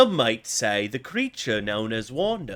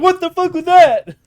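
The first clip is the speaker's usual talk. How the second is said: higher, louder confused yell; drunk guy confused; 13 dB louder than usual. Retold or imitated. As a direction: yell like a drunk who got shot. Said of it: "he's like".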